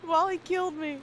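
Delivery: high put-on movie character voice; movie quote voice. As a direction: toss off the quote in a silly voice. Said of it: "accents and movie quotes".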